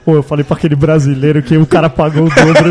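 mock waiter voice through laughter; laughing waiter impression. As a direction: boast in the cook's ear, laughing. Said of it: "falou assim".

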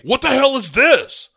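high, indignant boss outburst; mock outraged boss yelp. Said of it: "he's like".

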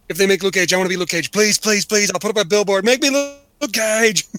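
escalating fanboy begging; building mock plea. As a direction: start casual, end in hammed-up pleading. Retold actor begging for role.

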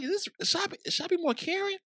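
high, incredulous retold disbelief; bewildered. Cousin retelling his reaction to the tantrum, high baffled disbelief.

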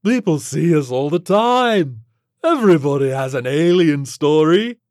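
breezy alien creature. Acted. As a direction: brag casually, stretching the words.

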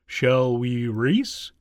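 very slow, drawn-out tortoise voice; unruffled tortoise. A narrator's reading. Tortoise inviting the hare to race, slow and steady. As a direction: draw each word out, rising into the question.

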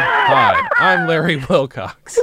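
Larry Wilcox ad voice. Pretend. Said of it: "do you know what isn't easy keeping up as an older gentleman".

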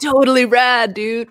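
lightly voiced nineties California surfer; a hint of a Californian surfer; surfer dude. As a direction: toss it off like a laid-back surfer dude. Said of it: "like, a nineties surfer dude from California".

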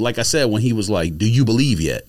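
ordinary talk

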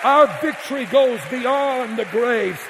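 rally the church to its feet; full-force preaching. Acted. Preacher's climax call to praise.

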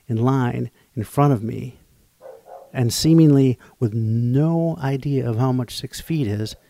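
ordinary delivery

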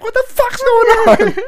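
very high, loud startled mom yell. Retold or imitated. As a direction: shout like a mom walking into chaos. Mom yelling into the fight.

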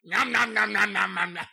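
imitating someone munching a sandwich; playful eating noises; mock chomping noises. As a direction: chew the sounds like a greedy eater. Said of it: "When he's eating the sandwich".

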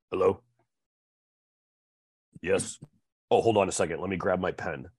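low, subdued imitation of a salesman; imitating off-putting salesman. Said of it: "this is how he sounded".

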